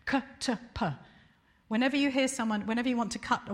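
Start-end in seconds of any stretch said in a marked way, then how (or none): 0.1-0.9 crisp demo of "k, t, p" as bare consonant bursts; hard consonant sounds. Shown as a demonstration. Teaching the audience where to cut, a crisp demo of "k, t, p". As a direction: spit out "k, t, p" as short hard stops. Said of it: "they teach you to cut on hard sounds".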